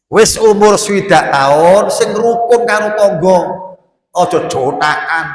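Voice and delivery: loud, high preacher's call; preaching at full force. Acted. Preacher warning the elderly congregation, loud and high. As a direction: lift the warning to the whole hall.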